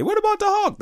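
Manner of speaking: high cartoon falsetto, disbelieving; squeaky doubting mutant. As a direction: squeak it out like a nervous sidekick. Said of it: "like Professor X would describe Wolverine".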